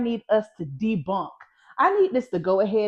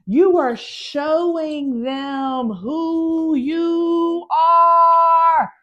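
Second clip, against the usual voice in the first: slow, drawn-out cry, higher and building louder; grand proclamation; 6 semitones higher, 4 dB louder, much slower than usual. Acted. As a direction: proclaim it like a rallying cry, stretching each word. Building up to a film-line impression.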